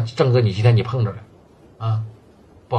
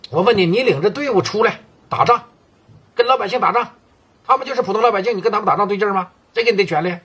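raised, scolding boss voice, higher pitched; angry scolding; 7 semitones higher than usual. Acted. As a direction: lecture him sharply, voice pitched up. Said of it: "郑哥就生气了".

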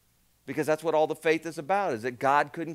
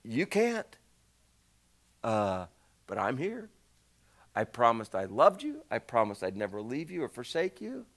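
1 gentle voice of God; 5 semitones lower than usual. Pretend. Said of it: "and God's going".

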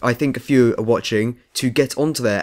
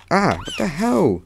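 high, drawn-out cry of alarm; slow yelp of disbelief; startled protest; 8 semitones higher, slower than usual. Real, not acted. Player suddenly taking damage in game, startled protest.

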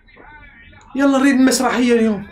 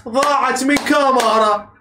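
normal talking voice

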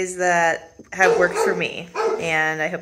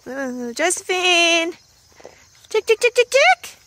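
high loud chick-chick call; calling chickens; 10 semitones higher, 5 dB louder than usual. Real, not acted. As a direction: call out for the lost hen. Searching for missing hen.

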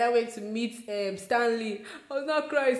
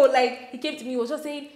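usual speaking voice

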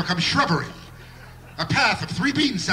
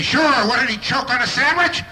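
loud, scornful jab; louder mocking snap; angry sneer; 4 semitones higher, 5 dB louder than usual. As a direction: bark it like a resentful neighbour. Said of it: "they get angry".

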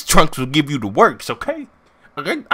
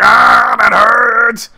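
loud, high cry of pain, hammed up; mock pained yell; 8 semitones higher, 10 dB louder, much slower than usual. Cell gets shot by her, exaggerated pained yell. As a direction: yell it like a cartoon villain who's hit.

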